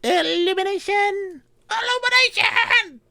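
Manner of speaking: high cartoon shout of a spell; cartoon spellcaster. Player casting a light spell like a cartoon, high squeal. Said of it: "because my children watch a lot of cartoons".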